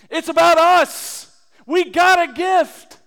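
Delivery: loud, high and slow proclamation; proclaiming. Real, not acted. Preacher proclaiming the gift to the congregation, loud and high. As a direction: ring it out slowly to the church.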